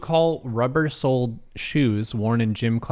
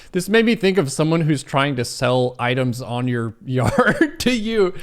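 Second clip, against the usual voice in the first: laughing; 3 semitones higher, 5 dB louder than usual. Real, not acted. Laughing at a quiz question.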